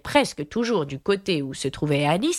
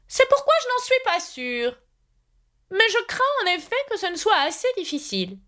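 high-pitched, solemn knight; very grave. A narrator's reading. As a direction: admit it gravely in a high character voice. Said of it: "répondit-il très gravement". (chevalier très grave, voix aiguë)

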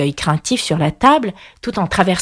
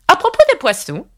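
high-pitched solemn royal declaration; 10 semitones higher, 3 dB louder than usual. A narrator's reading. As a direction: announce it with queenly gravity. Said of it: "déclara-t-elle très lentement et solennellement". (solennellement, voix de reine aiguë)

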